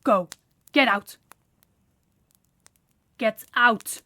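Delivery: loud, curt order to leave; angry dismissal. Acted. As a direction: throw them out with force. Throwing the servant out.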